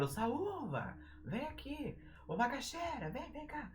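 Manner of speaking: high, soft mock calling voice. Shown as a demonstration. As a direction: beckon someone from afar in a small high voice. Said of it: "Chamar a palavra é melhor".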